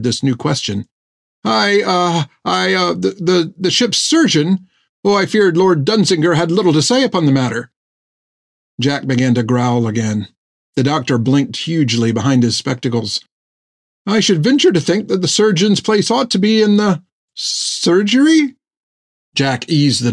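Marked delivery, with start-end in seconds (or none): 1.4-7.7 higher, stammering frightened voice; nervous stammer. A narrator's reading. 14.1-18.6 high, timid questioning voice; timid guess. A narrator's reading.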